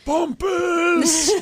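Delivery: high yelled "Bumpus"; movie quote shout. Retold or imitated. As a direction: bellow it like the angry dad.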